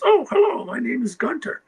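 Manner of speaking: put-on high, loud German accent for the greeting; imitating a German journalist's accent, bright and eager. German journalist greets him on the junket, German accent. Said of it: "in a German accent says".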